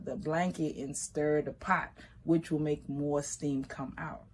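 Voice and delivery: ordinary delivery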